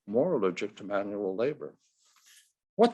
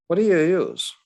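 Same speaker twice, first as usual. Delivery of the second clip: loud ballplayer question; 6 semitones higher, 8 dB louder than usual. A narrator's reading. Ted voiced.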